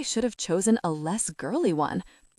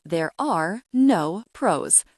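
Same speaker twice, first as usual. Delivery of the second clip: slow, deliberate put-down; slow and firm; 4 dB louder, slower than usual. Acted. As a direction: lay each word down slowly.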